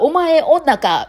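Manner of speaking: slow, high-pitched mock foreigner's Kansai dialect; foreign celebrity's Kansai dialect. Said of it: "外国のタレントさんが". (外国人タレント風の関西弁を真似て)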